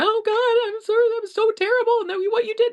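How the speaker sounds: high, loud overreacting parent; hammed-up gushing apology to a crying kid; mock panicked parent. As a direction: mimic a parent panicking over a fallen kid.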